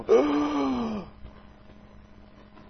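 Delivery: sharp high gasp of a shocked crowd. Retold or imitated. Preacher voicing the crowd's shock at the healing, loud gasp. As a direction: gasp like the whole crowd at once. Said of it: "almost hear the crowd go".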